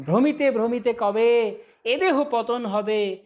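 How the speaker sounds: slow, chanted verse; devotional recitation. Acted. Preacher reciting Bhaktivinoda's hymn, slow and chanted. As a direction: intone the hymn lines slowly.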